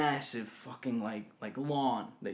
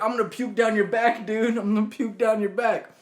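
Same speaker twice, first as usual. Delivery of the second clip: raised, higher voice, insistent; urgent warning; 7 semitones higher, 6 dB louder than usual. Retold or imitated. Drunk man to the friend carrying him, urgent raised warning. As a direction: warn him loudly and urgently.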